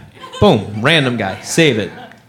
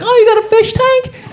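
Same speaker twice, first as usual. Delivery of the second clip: impressed guest. Retold or imitated. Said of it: "and be like".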